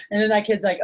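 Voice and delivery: usual speaking voice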